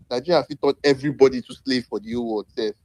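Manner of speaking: ordinary talk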